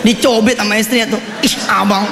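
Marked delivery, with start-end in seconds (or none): 1.4-2.1 coy wife. Acted. Wife pinches husband playfully.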